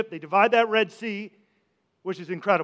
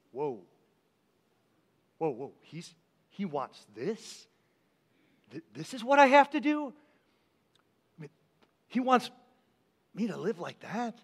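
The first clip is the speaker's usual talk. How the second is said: hushed, stunned disbelief, much softer; stunned awe; 2 semitones lower, 7 dB quieter than usual. Retold or imitated. Preacher voicing the stunned crowd hearing Jesus, hushed awe. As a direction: whisper it like the crowd is floored. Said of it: "they were out of their minds".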